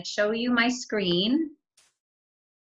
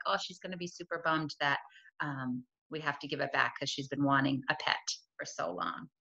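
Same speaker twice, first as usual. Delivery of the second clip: softer, fading into a whisper; quiet sad thought; 5 semitones lower, 8 dB quieter than usual. Retold or imitated. Teacher voicing her own sad thought about her daughter, hushed. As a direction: sigh it out as an inner worry. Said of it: "I was kinda sad".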